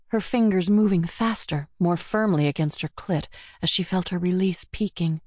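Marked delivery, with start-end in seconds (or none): none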